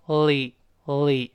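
li slowly as "lee", with a clear tongue-tip l; blended li. Shown as a demonstration. Teacher blends sounds for learners, li as slow "lee". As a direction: blend l into a long "ee" for li. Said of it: "再跟这个A一拼".

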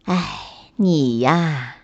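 resigned mother rabbit, voice dropped low; helpless resignation. A narrator's reading. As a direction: sigh at a hopeless child. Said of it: "无可奈何地摇了摇头".

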